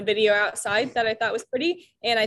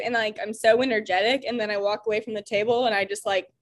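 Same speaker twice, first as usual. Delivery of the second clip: ordinary talk, nothing marked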